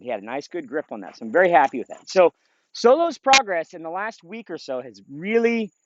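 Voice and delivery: ordinary talk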